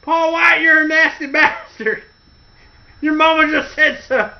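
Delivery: high, jeering taunt; mock taunt. Wrestling heel mocking a rival, jeering voice. Quoting Boss Man's taunt.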